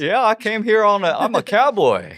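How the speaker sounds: put-on high, loud Southern cowboy accent. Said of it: "Can you do a Southern accent?".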